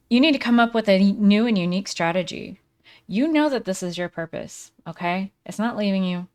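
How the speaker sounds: normal talking voice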